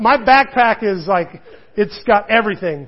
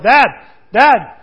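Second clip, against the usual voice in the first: put-on loud high calling of a child through a door; calling dad; 4 semitones higher, 9 dB louder than usual. Son calling his dad through the shut door, loud. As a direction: call out like a kid outside the bathroom.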